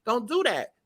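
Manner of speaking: imitating a high warning voice; high retold quote. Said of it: "She said".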